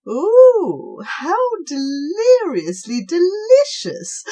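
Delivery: very high, loud squeal of delight; delighted snail. Acted. Snail thrilled with its cabbage leaf. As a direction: savour the treat.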